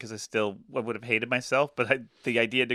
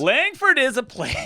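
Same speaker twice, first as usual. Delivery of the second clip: exasperated explaining; 12 semitones higher, 7 dB louder, slower than usual. Retold or imitated. Explaining flopped joke.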